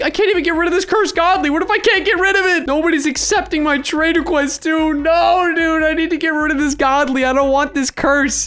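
high, worked-up pleading; frantic gamer fretting; panicky complaint. Real, not acted. Cannot give away cursed item.

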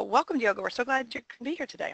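ordinary delivery

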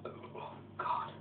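barely voiced, queasy breath; very soft, sickly whisper; queasy groan. Real, not acted. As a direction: groan under your breath, feeling sick. Feeling sick after chugging beer.

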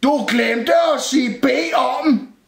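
slow, high cupboard holler; shouted reminder. A narrator's reading. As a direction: shout a slow, pedantic correction. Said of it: "råbte skabet". (råber langsomt)

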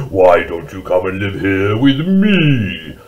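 slow, growly bear invitation; growling huge bear. Acted. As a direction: growl it slowly, swelling at the end. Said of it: "growled Huge Bear".

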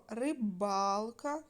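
рибалка drawn out slowly on БАЛ; slow риБАЛка, stressed syllable stretched; stress on middle syllable. Shown as a demonstration. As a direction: lean long on the first а in рибалка. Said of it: "поставте наголос".

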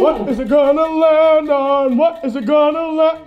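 slow, stretched-out wheel chant; slow, teasing showman suspense; drawn-out suspense. Acted. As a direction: draw out every word as the wheel spins.

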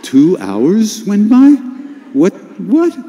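high, loud, drawn-out disbelief; stunned, voice leaping up in shock; acted astonishment. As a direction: play up being completely dumbfounded. Acts his shock at lost time.

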